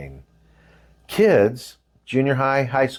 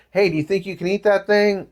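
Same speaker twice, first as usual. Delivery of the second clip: curious buddy; 9 semitones higher than usual. Retold or imitated.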